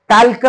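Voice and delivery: "टाल्क" with a clear L before the K; wrong pronunciation. Shown as a demonstration. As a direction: sound the L and final vowel in "टाल्क". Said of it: "म्हणायचं नाही". ("टाल्क", ल उच्चारून)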